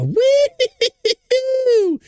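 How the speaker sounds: loud falsetto laughing whoop; high whoop. Real, not acted. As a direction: let out a high cackling "woo". Whoop ending his sung request.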